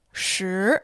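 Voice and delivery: Shi rising upward; second tone. Shown as a demonstration. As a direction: let Shi climb. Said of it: "has a second tone".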